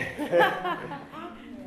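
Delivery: high loud laugh; big laugh. Real, not acted. Laughing at a panelist's teasing. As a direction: burst out laughing.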